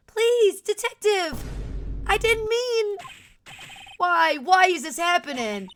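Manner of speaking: high, loud, distraught begging; acted anguished cry; desperate pleading. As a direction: plead and wail at the detective.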